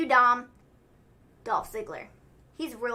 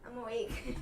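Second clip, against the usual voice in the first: softly mumbled, half-laughing reply; mock groggy sleeper; 8 dB quieter than usual. Dozing wrestler answering the camera crew, mock groggy sleeper. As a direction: answer quietly as if just roused, playing along.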